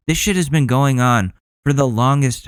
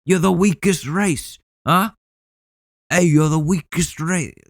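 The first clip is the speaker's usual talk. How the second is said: higher sneering imitation; mocking higher taunt; 6 semitones higher than usual. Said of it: "say shit like".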